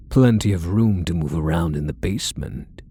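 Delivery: usual speaking voice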